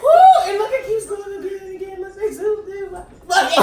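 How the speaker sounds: high, loud, laughing and fast; excited shouting. Real, not acted. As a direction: squeal it out in delight.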